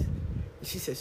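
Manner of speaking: normal talking voice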